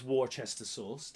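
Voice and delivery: Worcester said as spelt, "war-chester"; wrong pronunciation. Shown as a demonstration. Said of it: "it's not pronounced Worcester sauce".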